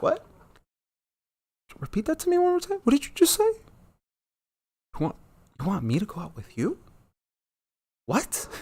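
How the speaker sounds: high, fast, mock-outraged disbelief; stunned disbelief. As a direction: act out hearing something absurd. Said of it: "I was like".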